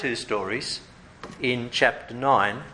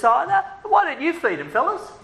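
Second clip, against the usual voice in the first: much higher, playful teasing challenge; Jesus turning it around; 12 semitones higher than usual. Retold or imitated. Jesus to the disciples, playful higher tease. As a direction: toss the question back with a grin. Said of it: "Jesus turns it around and says".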